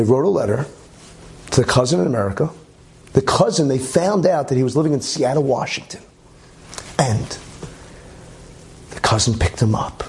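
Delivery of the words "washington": ordinary delivery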